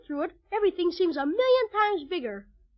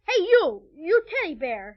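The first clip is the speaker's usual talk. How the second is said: calling out boldly; 3 dB louder than usual. Acted. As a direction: hail the bear forcefully. Challenging the toy bear aloud.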